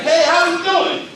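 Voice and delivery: high, loud, exaggerated hello; hammed-up fancy hello; weird overblown greeting. Shown as a demonstration. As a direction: greet with absurd over-the-top flair. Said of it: "It will sound very weird".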